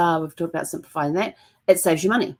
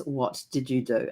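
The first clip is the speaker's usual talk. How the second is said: slow, softer and lower, holding back; weary low question after a deep breath; 4 semitones lower, 7 dB quieter, slower than usual. Retold or imitated. Husband, after a deep breath, asks about the paint slowly and quietly. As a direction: take a breath, then ask slowly and quietly. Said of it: "takes a deep breath and goes".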